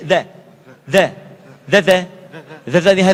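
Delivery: "the" as a voiced th through the teeth; punchy repeated "the" for students to copy; tongue lightly bitten. Shown as a demonstration. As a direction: bite the tongue tip gently on each "the". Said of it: "Sambil digigit".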